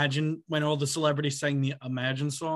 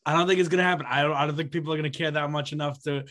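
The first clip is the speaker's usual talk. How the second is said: usual speaking voice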